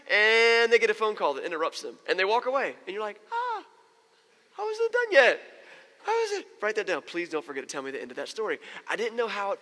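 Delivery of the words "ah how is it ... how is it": very high, squealed exasperation; mock frustration. Friend left hanging mid-story by a phone call, mock frustration. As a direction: squeal it in hammed-up suspense.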